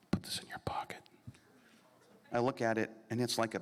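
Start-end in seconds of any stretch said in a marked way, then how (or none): 0.1-1.0 imitated furtive whisper; secretive whisper. Dad slipping his son a bracelet, secretive whisper. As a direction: whisper it under the breath, conspiratorially.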